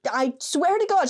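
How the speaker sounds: ordinary delivery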